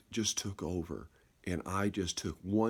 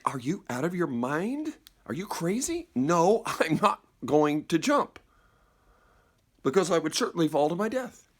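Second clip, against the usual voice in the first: alarmed refusal; 6 semitones higher than usual. Acted. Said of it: "the other voice immediately was, is responded and reacted".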